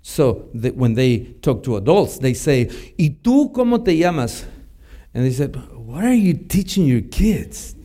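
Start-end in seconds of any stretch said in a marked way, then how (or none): 3.0-4.4 put-on higher, bright kid question; children on a mission trip chatting to adults, higher and eager; imitating the kids. Kids addressing adults informally. 5.7-7.7 mock indignant. Offended adults question parents.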